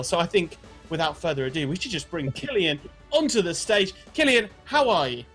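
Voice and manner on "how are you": ordinary talk, nothing marked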